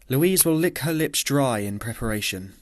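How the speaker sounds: plain reading voice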